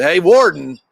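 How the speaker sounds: put-on old John calling the warden in again, loud. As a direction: shout it toward the trees with a grin. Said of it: "steps out of the cabin and says".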